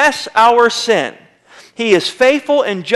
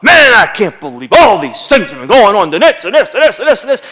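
louder, laughing mock rant; mock explosion; 5 dB louder than usual. Husband acting out exploding at his wife, louder mock rant. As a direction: blow up in a half-laughing fake rage. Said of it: "We blow up".

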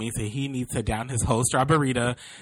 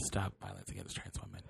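said much softer; softer repeat; 12 dB quieter than usual. Shown as a demonstration. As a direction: drop the volume on the repeat. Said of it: "that was a good message".

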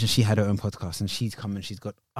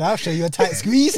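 higher, louder teasing reply; flirty comeback; 5 semitones higher, 5 dB louder than usual. Retold or imitated. Friend retelling her bold reply, teasing. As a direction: toss it back as a cheeky flirt. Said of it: "And she said".

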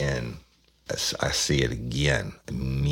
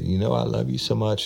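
crying; 11 semitones higher, 3 dB louder than usual. Retold or imitated. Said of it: "She started crying shit again".

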